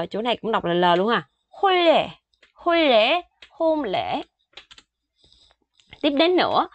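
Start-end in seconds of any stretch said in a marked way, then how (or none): 1.5-3.2 hôn lễ sung high with both sounds read as L; L pronunciation. Shown as a demonstration. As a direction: say hôn lễ with a clear L. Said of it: "chỗ này đọc là L".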